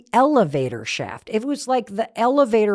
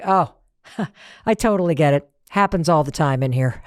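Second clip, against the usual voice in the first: laughing reassurance; 6 semitones lower than usual. Retold or imitated. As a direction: chuckle through it, lower and easygoing. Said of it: "He laughed and he was like".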